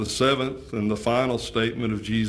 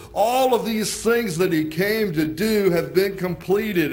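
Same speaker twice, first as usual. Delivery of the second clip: triumphant proclamation; 6 semitones higher, 3 dB louder than usual. Acted. Preacher voicing Jesus' triumph on the cross, ringing out. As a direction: proclaim it like a victor's shout.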